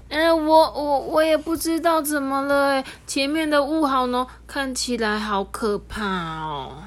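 high, stammering, nervous little voice; little train answering timidly, afraid of the fog; timid, embarrassed. Acted. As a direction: falter as if scared of the fog. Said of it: "很不好意思的回答".

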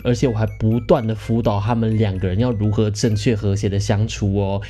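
ordinary talk, nothing marked

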